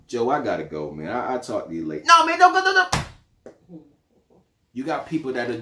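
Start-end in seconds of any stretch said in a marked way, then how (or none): 0.1-2.0 brushing him off. Acted. Acted phone call, receiver. 2.1-3.0 much higher and louder, fake-friendly phone greeting; overeager cousin. Acted phone call, cousin caller.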